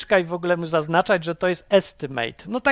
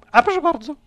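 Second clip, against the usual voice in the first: mock permission; 10 semitones higher, 4 dB louder, faster than usual.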